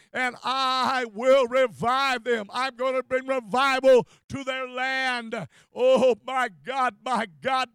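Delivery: loud, rising preacher cry, slowing on key words; preaching at full force. Acted. Preacher crying out for revival to congregation, loud and fervent. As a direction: thunder the promise and beg for revival. Scripture then fervent prayer.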